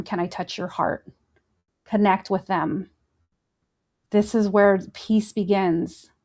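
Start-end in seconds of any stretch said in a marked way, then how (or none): 0.0-1.1 gentle asking. Retold or imitated. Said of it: "ask them".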